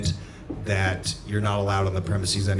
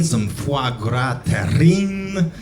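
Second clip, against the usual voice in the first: put-on accidental Scottish accent, higher and drawn out; louder, slow mock-Scottish delivery; bad Scottish accent; 4 semitones higher, 5 dB louder, much slower than usual. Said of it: "I accidentally did this bad Scottish accent".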